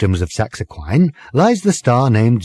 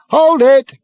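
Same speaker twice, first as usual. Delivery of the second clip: high, loud barked order; shouted command; 6 dB louder than usual. Acted. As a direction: stop everyone with a yell. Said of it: "he shouted again".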